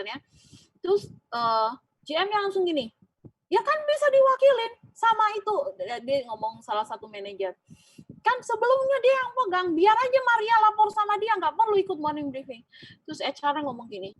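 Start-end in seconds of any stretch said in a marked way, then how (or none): 3.5-5.6 high, loud, brushing-off retort; dismissive boss. Retold or imitated. GM brushes off HR manager's defense, high and loud. 8.2-12.6 high, loud, brushing-off retort; dismissive boss. Retold or imitated. GM brushes off HR manager's defense, high and loud. Boss insists she report elsewhere.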